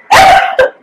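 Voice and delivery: very high, breath-thin wail; squeaky burst of pretend crying; mock wail. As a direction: imitate a sudden sob escaping. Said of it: "an audible wail came out".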